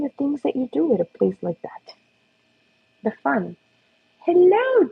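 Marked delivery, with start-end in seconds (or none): 4.2-4.8 high dog greeting. Real, not acted.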